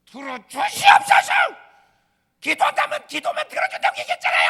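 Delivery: loud, high demanding cry; defiant shouting. Acted. A fasting believer yells demands at God. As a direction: scream your demand at heaven. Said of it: "막 이렇게 대들어".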